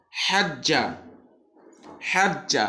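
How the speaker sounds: হাজ্জা with the doubled j held long, slow; tashdid drill. Shown as a demonstration. Teacher drills tashdid for students, clear recitation. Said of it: "আমার পরপরই পড়ুন".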